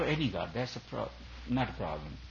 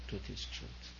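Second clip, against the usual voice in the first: truth is truth whispered, much softer; 10 dB quieter than usual. Shown as a demonstration. Guru to retreat audience, hushed whisper. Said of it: "Whether said sweetly or whether said harshly".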